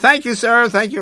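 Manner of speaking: high, loud sugary thanks; fake polite shopkeeper. Saleswoman seeing the shouting customers out, forced polite thanks. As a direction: hurry them out with forced politeness.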